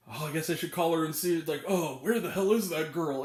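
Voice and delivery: low, offhand mock grumble; imitating Graham Greene. Critic mimics actor deciding to call a girl, low offhand grumble. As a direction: put on a low, bothered movie-character voice. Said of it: "Graham Greene is just like".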